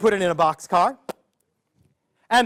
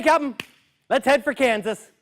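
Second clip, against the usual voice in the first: plain reading voice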